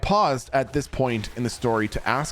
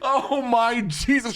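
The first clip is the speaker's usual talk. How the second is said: laughing; 11 semitones higher, slower than usual. Real, not acted. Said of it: "laughing aftermath".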